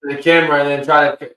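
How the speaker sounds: normal talking voice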